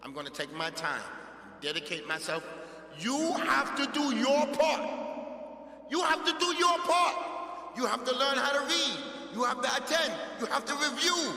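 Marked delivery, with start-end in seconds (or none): 3.0-11.3 loud, high, ringing demand; forceful exhortation. Real, not acted. Teacher demanding students do their part, loud and forceful. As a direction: hammer each duty out at full voice.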